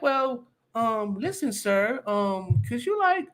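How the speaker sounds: high, hesitant, meek asking; timid pushover. Retold or imitated. Mocking the timid husband asking a man politely to move. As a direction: play the scared guy who won't stand up. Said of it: "And I wasn't like".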